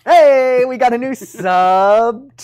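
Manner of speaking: loud, high, slow excited call-out; booming celebratory hey; excited shout. Real, not acted. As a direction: cheer the new subscriber loudly. Excited shout to new subscriber.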